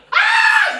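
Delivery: very high, loud shriek; high loud scream at the snake; girly scream. Retold or imitated. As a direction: scream high like a frightened girl. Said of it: "기집애처럼 소리를".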